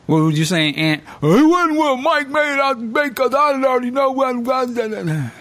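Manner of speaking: high, exaggerated know-it-all whine; hammed-up imitation of a listener; mocking know-it-all. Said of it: "I put a little extra on it".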